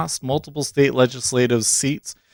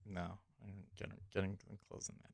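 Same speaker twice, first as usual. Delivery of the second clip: whispered; 2 semitones lower, 27 dB quieter, much faster than usual. Real, not acted. Muttering while closing window.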